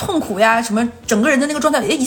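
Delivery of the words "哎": normal talking voice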